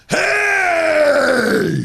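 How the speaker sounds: high, loud street yell; sudden high shout, mock maniac; scream. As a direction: bellow at strangers across the street. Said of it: "scream at people".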